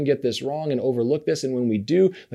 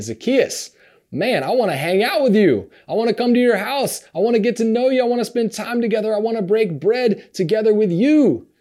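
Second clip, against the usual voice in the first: higher and louder, bursting with eagerness; eager invitation; 8 semitones higher, 5 dB louder than usual. Retold or imitated. Jesus to Zacchaeus, wanting to visit him, excited and louder. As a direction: pitch it up and pour out the excitement.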